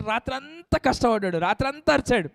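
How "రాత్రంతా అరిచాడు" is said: ordinary delivery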